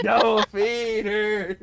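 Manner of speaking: high, loud, drawn-out cry; mock outcry. Playing along with the cartoon bit, drawn-out mock outcry. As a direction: stretch it out at full volume.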